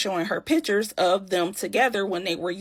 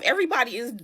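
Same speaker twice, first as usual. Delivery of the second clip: usual speaking voice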